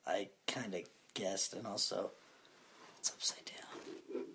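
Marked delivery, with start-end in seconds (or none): 3.0-4.2 whisper. Real, not acted. Whispered aside about phone.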